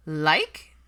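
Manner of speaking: loud scoffing one-word question; incredulous. A narrator's reading. Pansy scoffing at understatement.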